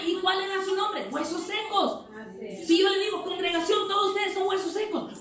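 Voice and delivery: high-pitched, insistent sermon; impassioned preaching. Real, not acted. Preacher challenging the church by his own example, impassioned preaching.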